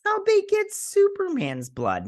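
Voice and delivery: very high, drawn-out squawk; slow falsetto mock outrage; mock disbelief. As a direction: squeal it slowly in put-on disbelief.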